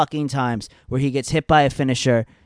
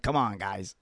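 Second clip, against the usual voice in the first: usual speaking voice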